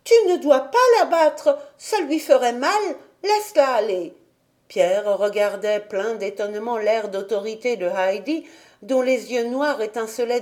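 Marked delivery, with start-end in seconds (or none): 0.0-4.1 put-on raised, indignant child's voice; indignant cry. A narrator's reading. Heidi holds back Pierre's arm, indignant. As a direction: order him off, outraged. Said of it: "s'écria indignée".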